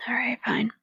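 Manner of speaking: breathy whisper; whispered resignation. Acted. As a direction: hush it right down under the breath. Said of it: "I'm not gonna do the woo voice".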